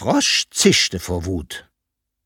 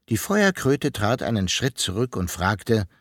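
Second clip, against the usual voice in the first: ordinary delivery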